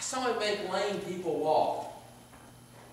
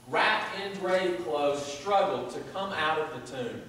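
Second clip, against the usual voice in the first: normal talking voice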